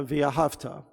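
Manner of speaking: "ve'ahavta" as ve-a-HAV-ta; stress on second-last syllable. Shown as a demonstration. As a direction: hit HAV, leave the "ta" of "ve'ahavta" weak. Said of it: "important mispronunciation of that word".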